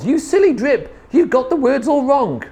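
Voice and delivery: high, exasperated telling-off; scolding yell. A narrator's reading. Sisters correct Drip's chant, high scolding yell. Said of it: "but the others yelled".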